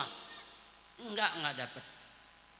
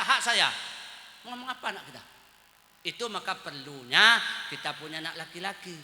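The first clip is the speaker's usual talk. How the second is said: ordinary talk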